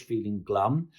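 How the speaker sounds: usual speaking voice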